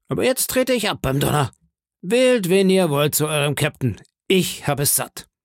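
a pirate chief warning off a mouthy sailor; Silver threatening. Acted. Silver threatens George in front of the crew, scolding pirate voice.

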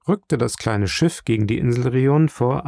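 usual speaking voice

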